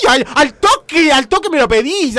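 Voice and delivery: loud excited exclamation; excited outburst. Real, not acted. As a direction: blurt it out, thrilled. Comic outburst after seduction skit.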